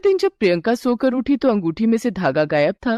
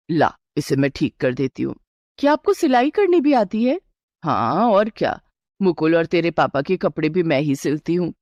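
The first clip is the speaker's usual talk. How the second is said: a lightly lowered, steady mother-in-law; 3 semitones lower than usual. Acted. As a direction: reassure the bride as a knowing elder. Mother-in-law offers to sew. (हल्की सी नीची आवाज़ में सास)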